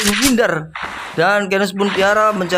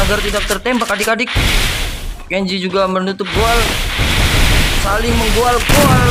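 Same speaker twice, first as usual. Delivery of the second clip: put-on lively play-by-play for kids; mock sports commentary; 3 dB louder than usual. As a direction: call the match like a hyped caster. Live game play-by-play for kids. (komentator pertandingan yang bersemangat)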